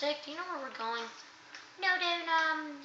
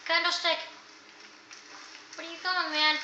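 ordinary delivery

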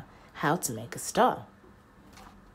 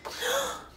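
sharp gasp. Acted. Character gasps.

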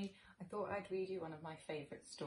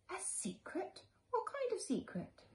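put-on much higher, eager child's voice; curious little boy; slower than usual. Little Chester asking his mother about the secret, eager and high. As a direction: perk up like a child hearing of a secret.

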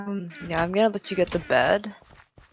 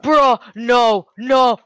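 loud, raised cries of dismay; protesting shout; 5 semitones higher, 8 dB louder, much slower than usual. Real, not acted. Player protesting to a teammate mid-game, dismayed shout. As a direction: shout it in separate bursts.

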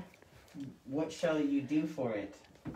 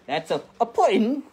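high, loud mock-British introduction; hammed-up British accent, pitched up; attempted British accent; 7 semitones higher, 8 dB louder than usual. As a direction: announce the cat in over-the-top British. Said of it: "that accent you're doing".

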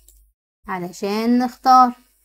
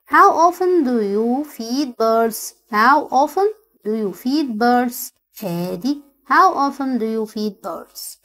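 very slow dictation; much slower than usual. Shown as a demonstration.

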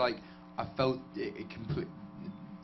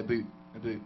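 light mock Canadian accent. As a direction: say boot the Canadian way. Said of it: "do another Canadian accent".

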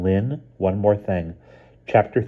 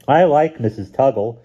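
put-on a small child's voice, higher and louder; a cheerful little brother chirping; little boy; 7 semitones higher, 7 dB louder than usual. A narrator's reading. Stevie at breakfast telling his sister, in a high child's voice.

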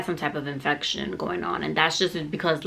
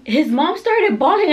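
incredulous storytelling; 10 semitones higher, 6 dB louder than usual. Retold or imitated. Worked-up retelling of relatives crying.

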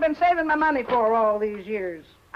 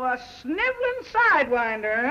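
normal talking voice

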